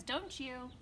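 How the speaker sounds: usual speaking voice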